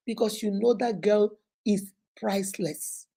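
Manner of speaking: soft whispered aside; hushed confidential whisper. Real, not acted. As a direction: whisper it like a secret.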